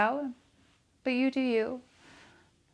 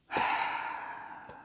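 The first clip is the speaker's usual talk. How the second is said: long, soft breathy sigh; 4 dB quieter than usual. Real, not acted. Teacher settling before reading the quote, soft sigh. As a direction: let out a slow, quiet exhale.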